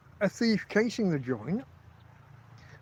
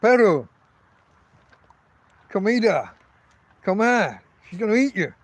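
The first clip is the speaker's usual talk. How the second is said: raised voice warning off a stranger; louder warning that the dog will bite; warning call; 3 semitones higher, 5 dB louder than usual. Retold or imitated. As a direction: call it out loud, threatening. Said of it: "I'm like".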